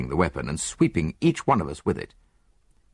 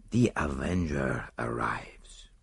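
slow, hushed whisper; dramatic whisper; 5 semitones lower, 7 dB quieter, slower than usual. Acted. Poirot dramatizing the avenger for Hastings, slow whisper. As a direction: whisper it like a ghost story.

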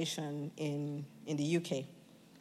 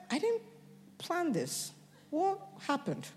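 surprised; 9 semitones higher, 4 dB quieter than usual. Retold or imitated. Alarmed at own changed words.

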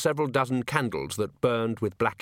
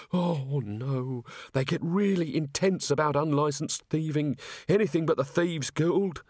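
mildly panicked, raised voice; alarmed pleading; 4 semitones higher than usual. A narrator's reading. Thief pales at mention of the Thieves' Guild, alarmed. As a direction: plead to be spared a worse fate. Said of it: "The quick thinker went pale".

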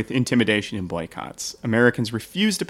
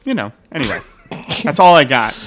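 loud, hammed-up Boston accent; brash Boston local ordering Dunkin', hammed-up Boston accent; 6 semitones higher, 10 dB louder than usual. Playing a Boston local for friends, loud Boston accent. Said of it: "That is his best accent".

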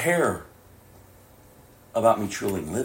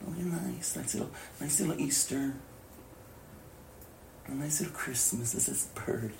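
soft sarcastic whisper; mocking whisper; 4 semitones higher, 15 dB quieter than usual. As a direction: sneer at the sweet little holiday. Mocking cheapened Christmas.

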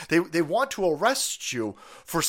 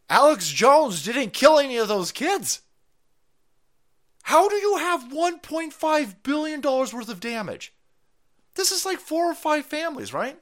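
loud, high indignant rant; furious ranting commentary; outraged rant; 10 semitones higher, 5 dB louder than usual. Real, not acted. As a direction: vent the outrage at the ruling. Ranting against the verdict.

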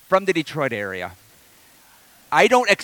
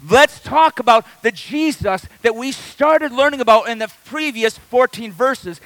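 raised, driving pulpit voice; a pastor rousing his congregation, preaching hard; full-force preaching; 4 semitones higher, 4 dB louder than usual. Acted. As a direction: preach it out to the whole room, building.

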